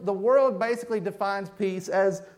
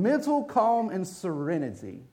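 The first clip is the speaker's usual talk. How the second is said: ordinary talk, nothing marked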